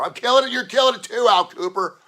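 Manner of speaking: loud, high, pumped-up shouting; hyped shout. Real, not acted. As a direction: yell it out to hype your buddy. Hyping up co-host on air.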